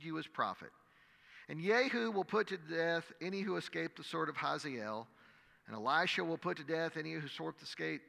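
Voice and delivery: measured, dramatic Bible reading to a congregation; scripture reading. Acted. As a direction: read the passage aloud like a preacher. Reads the scripture passage.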